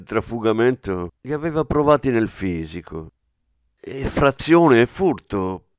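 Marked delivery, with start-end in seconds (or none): 3.8-5.7 mumbled through a mouthful, slow; mouth full. Acted. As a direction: talk round a bite of sandwich. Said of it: "bofonchiò Zurlini con la bocca piena".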